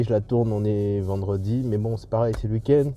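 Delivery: ordinary talk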